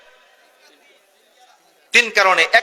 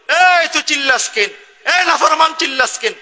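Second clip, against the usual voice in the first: high, sharp scolding question; stern rebuke; 8 semitones higher than usual. Acted. God rebuking the shouting sinner, sharp and high. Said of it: "আল্লাহ তখন বলে".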